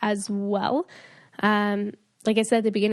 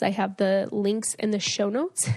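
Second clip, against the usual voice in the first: ordinary talk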